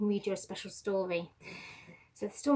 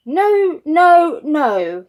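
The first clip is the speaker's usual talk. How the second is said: much higher and louder, panicked no no no; frantic denial; 6 semitones higher, 16 dB louder, much slower than usual. Acted. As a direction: push the accusation away. Peter denies Jesus in panic.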